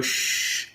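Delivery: soft unvoiced shushing like falling rain; rain sound. Shown as a demonstration. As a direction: shush softly like rain for a child. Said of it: "O som da chuva?".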